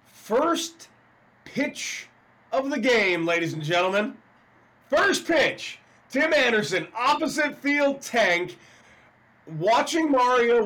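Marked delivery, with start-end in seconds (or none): 0.0-8.6 high, loud play-by-play call of the homer; excited home run call. Pretend.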